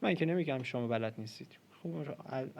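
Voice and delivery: ordinary delivery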